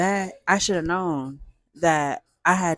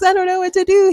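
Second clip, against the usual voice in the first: much higher and louder, near a cry; panicked; 12 semitones higher, 8 dB louder, faster than usual. Retold or imitated. To the neighbor about her broken car, a loud high wail. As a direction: wail it helplessly. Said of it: "So I was like".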